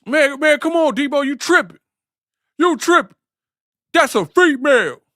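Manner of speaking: very high, loud whiny pleading. Retold or imitated. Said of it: "Q act like that in every movie".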